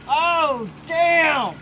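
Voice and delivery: normal talking voice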